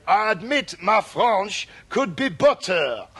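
put-on slow mangled French-accented English; bad French accent. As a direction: confess in a hammy cod French accent. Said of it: "he admitted to".

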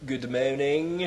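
put-on slow mispronounced French-accented "morning"; cod French accent. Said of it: "he pronounced words wrong".

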